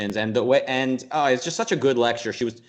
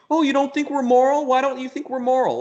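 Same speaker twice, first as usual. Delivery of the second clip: high, whiny mock plea; mocking pleading scientist; 12 semitones higher, 4 dB louder than usual. [name] mocking scientists begging Christians, high whiny plea. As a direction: beg for approval in a much higher voice. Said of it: "[name] was, like, mocking them with, with her voice".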